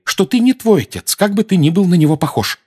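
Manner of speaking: high, loud, emphatic answer; heated insistence. A narrator's reading. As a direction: press the point forcefully, pitch high. Mrs Weasley's sharp answer in quarrel. (запальчиво и громко)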